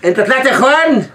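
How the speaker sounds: incredulous. Acted. Officer mocking the prisoner's answer with high disbelief. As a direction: act shocked at the answer.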